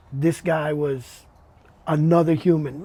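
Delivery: ordinary talk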